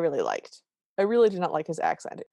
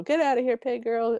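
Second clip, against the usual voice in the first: higher-pitched step-family jeer; mock call-out, pitched up; second heckling voice; 6 semitones higher than usual. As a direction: echo the taunt in a different, higher voice. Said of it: "They do different voices".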